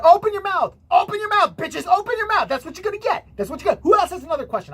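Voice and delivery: high, loud taunting shout; mock aggressive cadence. Man taunting a crowd, mock shouted tirade. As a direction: bellow it as a mock power trip. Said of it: "with the cadence that this guy had".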